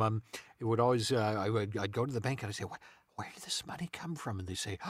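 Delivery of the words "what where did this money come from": hushed secret whisper. Retold or imitated. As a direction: whisper the question to the teller. Asking the bank in secret.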